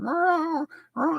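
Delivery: high mock cat meows; cat meowing. A man telling of his baying cats, imitating cat meows. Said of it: "and they're going like".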